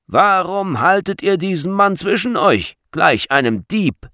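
loud, high-pitched demand; emphatic, reproachful question slowing at the end; challenging pastor. A narrator's reading. Pastor challenges guards holding Tell.

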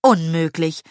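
ordinary delivery, nothing marked

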